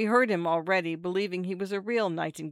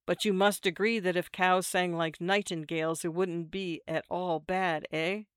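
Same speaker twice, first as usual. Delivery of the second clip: ordinary delivery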